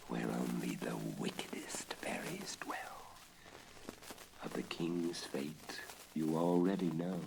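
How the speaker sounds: eerie unvoiced whisper; whispered menace of the underworld. A narrator's reading. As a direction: hush it like a dark secret. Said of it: "teaser line whispered".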